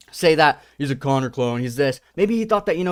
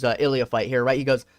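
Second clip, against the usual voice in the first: ordinary talk, nothing marked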